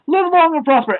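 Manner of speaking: high-pitched mock greeting; put-on high voice for a costumed fan; Spock fan quote. Spock fan greeting two comic characters from behind, high mock voice.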